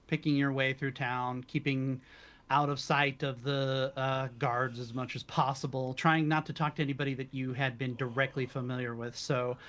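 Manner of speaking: ordinary delivery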